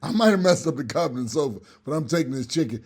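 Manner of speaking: ordinary talk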